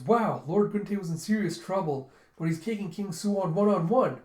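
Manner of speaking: retold excited play-by-play; excited commentary. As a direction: call the duel like a gripped onlooker. Spectator commenting on duel.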